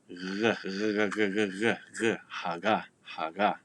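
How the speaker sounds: repeated throaty g, air flowing, then haga; "g, g, haga" with g as a continuous fricative. Shown as a demonstration. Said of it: "rather than fully stopping the flow of air".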